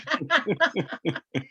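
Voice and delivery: high laugh. Real, not acted. Laughs at own joke.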